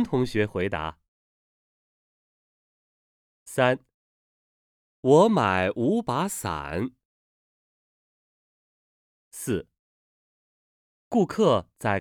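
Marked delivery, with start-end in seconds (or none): 5.0-6.9 我买五把伞 read slowly with each third tone clear; slow tone model. Shown as a demonstration. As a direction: spell out the tones for learners. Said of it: "注意韵母、声调的读法和轻重音".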